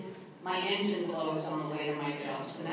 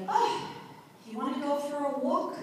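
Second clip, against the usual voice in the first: husband's invitation; 8 semitones higher than usual. Retold or imitated. Husband voiced in retold story.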